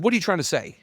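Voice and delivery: retold ticked-off challenge. Client confronting his counselor, retold ticked-off challenge. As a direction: push back, acting out irritation. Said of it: "he ticked me off".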